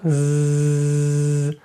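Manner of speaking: a long, steady Ukrainian "з" buzz; pron: held z. Shown as a demonstration. Teacher has students start from a held Ukrainian "з". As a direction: hold the "з" sound out steadily. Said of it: "Кажете просто український звук".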